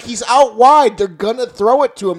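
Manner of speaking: high, loud exasperated shouting; ranting at the TV. Retold or imitated. Fan yelling at the coaches through the TV, loud and exasperated. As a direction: lecture the coaches at full volume. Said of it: "I'm like".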